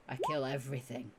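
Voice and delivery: low, quiet, self-assured brag; grinning boast. Acted. Grinning warrior showing off her great axe, low quiet boast. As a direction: say it low and softly through a grin. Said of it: "she gives you a grin".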